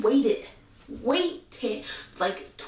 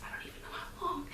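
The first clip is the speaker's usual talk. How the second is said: whisper; 2 semitones higher, 17 dB quieter than usual. Real, not acted. Confiding aside to viewers.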